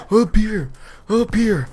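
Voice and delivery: mock calling across the room; calling out to friend. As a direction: imitate a guy flagging down his friend. Man waving, calling his friend.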